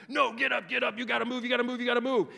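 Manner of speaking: coach yelling. Retold or imitated. Preacher voicing a coach yelling at a tired player, loud bark. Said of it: "coaches when they're yelling at you".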